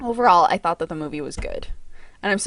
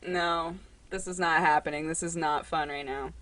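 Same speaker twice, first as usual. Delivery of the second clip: grumpy cat voice; 3 semitones lower, 7 dB quieter than usual. Retold or imitated. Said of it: "He's like".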